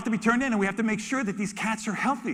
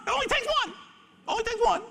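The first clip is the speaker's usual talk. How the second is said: much higher, eager repeat; excited high cry. Real, not acted. Keeper insisting one is enough, excited high. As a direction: sing out the hope.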